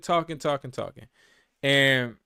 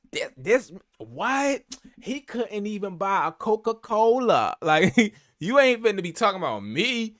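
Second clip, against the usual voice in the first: mock gossip; 8 semitones higher, 6 dB louder than usual. Imitating partner gossiping.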